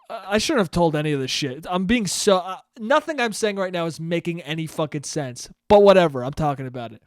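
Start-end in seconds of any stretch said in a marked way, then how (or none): none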